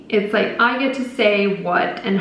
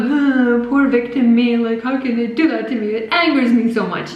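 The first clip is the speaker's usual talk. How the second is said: lightly hammed-up self-pitying voice; mock victim whine. Mocking her own self-pity while she cried about it, victim whine. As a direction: play the poor victim, half mocking. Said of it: "I just felt like".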